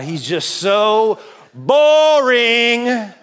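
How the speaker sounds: ordinary talk, nothing marked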